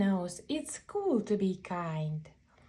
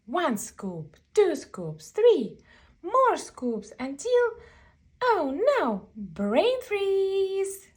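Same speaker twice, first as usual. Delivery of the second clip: rising higher and louder to a squeal; building excitement; 8 semitones higher, 4 dB louder than usual. A narrator's reading. Reader builds to a louder, higher brain freeze punchline. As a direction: pile up the scoops to a brain freeze.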